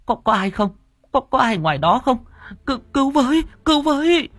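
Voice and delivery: high-pitched, frantic calling out; panicked cry for help. A narrator's reading. Bà Hỏa calls for anyone outside, panicked. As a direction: cry for help, pitch climbing with each call. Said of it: "Bà Hỏa hoảng hốt".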